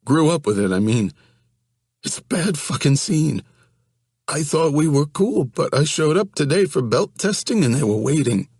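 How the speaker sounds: high, panicky, pleading voice; whiny scared young man. Acted. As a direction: voice him as a frightened, whining guy. Johnny panics to Evan.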